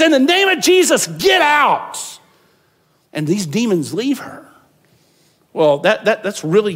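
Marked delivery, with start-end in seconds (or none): 0.0-2.0 high, loud exorcism command; commanding shout. Retold or imitated. Paul ordering the demon out of the girl, loud command. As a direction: order the demon out with full force.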